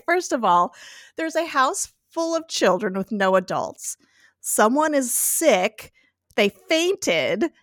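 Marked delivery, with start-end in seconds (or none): none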